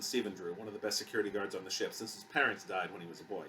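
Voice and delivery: plain reading voice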